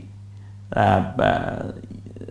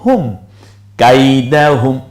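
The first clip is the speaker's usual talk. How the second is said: slow drawn syllables; 5 dB louder, slower than usual. Shown as a demonstration. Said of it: "syllable-by-syllable Quran reading demo".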